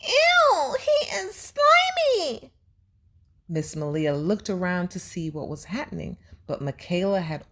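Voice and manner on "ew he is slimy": very high, slow squeal of disgust; a girl shrieking at slime; shouted in disgust. A narrator's reading. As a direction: squeal it like a grossed-out kid. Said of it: "she shouted".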